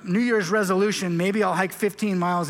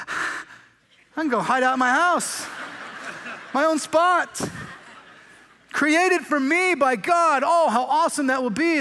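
overwhelmed delight; 5 semitones higher, slower than usual. Retold or imitated. Introvert imagining his own heavenly house, slow delighted voice. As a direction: savour each word like a private gift.

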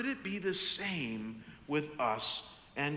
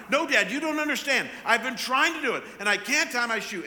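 loud, high, rushed imitation of a toddler's protest; mock a distraught child's tantrum; dramatic screaming; 7 semitones higher, 7 dB louder than usual. Father imitating daughter cutting him off, dramatic mock screaming. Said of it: "just screaming that she can't tie it".

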